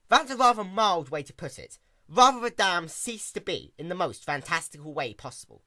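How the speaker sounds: usual speaking voice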